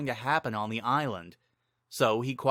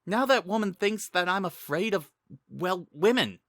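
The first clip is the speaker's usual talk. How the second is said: lightly voiced, embarrassed young man, a touch higher; embarrassed Link; 9 semitones higher than usual. Link embarrassed after Sing's joke, lightly voiced. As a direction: grumble with embarrassment.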